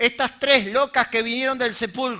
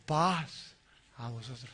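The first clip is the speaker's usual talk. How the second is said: very soft, low mystical hush; 13 semitones lower, 14 dB quieter, slower than usual. Shown as a demonstration. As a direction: intone it like a solemn blessing, fading away. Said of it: "en ese lenguaje suena hasta místico". (místico, grave y muy suave)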